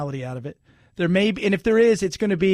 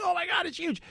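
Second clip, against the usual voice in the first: high, soft mock awe; imitated amazement; 10 semitones higher, 7 dB quieter than usual. Fairgoer seeing a giant horse, imitated amazement. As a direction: gasp it like an awed fairgoer. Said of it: "And you're like".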